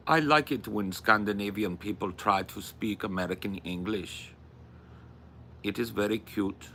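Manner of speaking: put-on wobbly Scandinavian accent drifting toward Indian; attempted Scandinavian-accented English. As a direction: imitate a Scandinavian speaking English. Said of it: "Why are they sounding Indian now?".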